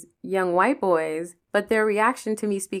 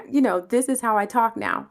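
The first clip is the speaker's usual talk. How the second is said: ordinary talk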